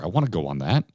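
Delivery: ordinary talk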